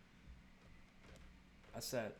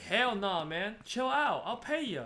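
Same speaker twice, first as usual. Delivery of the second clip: high, loud dismissive brush-off, much higher and louder; mock brush-off; 10 semitones higher, 9 dB louder, slower than usual. To an imagined asker: mock brush-off, high and loud. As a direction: wave off the person asking for the money. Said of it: "I should just say".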